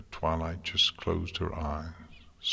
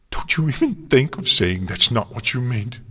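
loud, high-pitched angry warning; 6 semitones higher, 8 dB louder than usual. A narrator's reading. Twilight warning Celestia not to take it back, angry. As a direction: warn them off with raised voice. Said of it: "Twilight growled".

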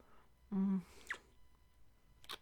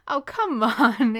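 coaxing; 4 semitones higher, 10 dB louder, slower than usual. Acted. Coaxing her hesitant lover.

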